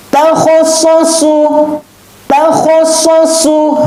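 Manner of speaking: high, drawn-out chanting of the syllables; Quran recitation. Shown as a demonstration. Teacher recites for pupils to copy, high and drawn out. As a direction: chant it like a tajwid teacher showing the sound. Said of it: "Perhatikan sama ndak dengan Ustadz".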